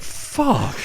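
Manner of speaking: high, strangled mock wail; dismayed driver. The driver seeing his wrecked van, in a mock wail. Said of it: "He's like".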